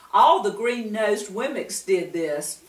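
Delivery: plain reading voice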